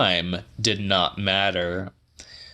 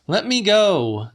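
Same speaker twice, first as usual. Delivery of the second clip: voiced much higher as the woman; Celia's voice; 11 semitones higher, 3 dB louder than usual. A narrator's reading. Woman held by the wrists, asking to be freed, higher voice. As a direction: plead to be let go, pitched up.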